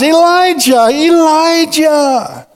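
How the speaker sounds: calm him down gently; gentle divine reassurance; reassuring God. Acted. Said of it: "God responds".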